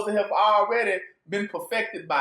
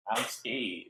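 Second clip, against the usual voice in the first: softer and lower, a small frightened murmur; mock scared; 9 semitones lower, 10 dB quieter than usual. Preacher repeating what the flock hears, hushed mock fear. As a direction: shrink the voice down like a scared follower. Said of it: "That's all they hearing out of your mouth".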